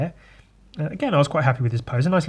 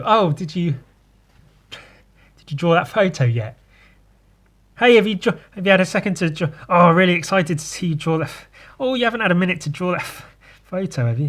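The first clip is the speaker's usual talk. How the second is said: nagging sender; 6 semitones higher, 4 dB louder, faster than usual. Retold or imitated. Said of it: "they go".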